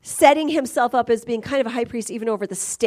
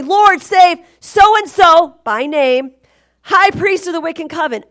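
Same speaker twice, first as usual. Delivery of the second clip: fervent prayer; 5 semitones higher, 7 dB louder than usual. Retold or imitated. Retelling her own public prayer.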